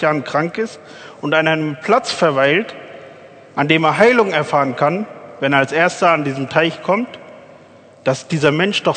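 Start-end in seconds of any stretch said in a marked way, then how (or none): none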